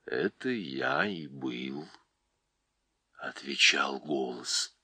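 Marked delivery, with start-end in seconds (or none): 0.0-1.9 low, slow and gentle; a wise old voice, unhurried; deep calm voice. Acted. Голос Льва. (низкий ласковый голос)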